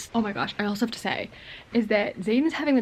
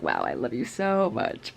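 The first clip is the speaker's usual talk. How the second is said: low, slow lovestruck murmur; Zayden's devoted voice; 10 semitones lower than usual. Retold or imitated. Recapping Zayden's line to fans, low and lovestruck. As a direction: drop the voice deep and slow, smitten.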